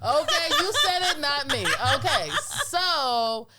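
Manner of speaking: high, loud laughing. Real, not acted. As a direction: laugh through the words.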